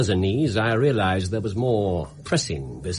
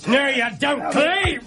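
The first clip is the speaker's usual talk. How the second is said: shout. Acted. Holmes seizing the thief.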